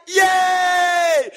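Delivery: high, loud mock cheer; kids cheering. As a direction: cheer like a kid on the drop. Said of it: "they're yelling in the back".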